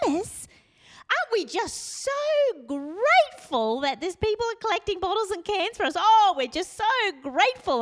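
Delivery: high, sugary gratitude act; exaggerated high-pitched thankfulness; gushing cover-up. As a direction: gush gratefully to drown out the grumbling. Covering for Thomas as lady approaches.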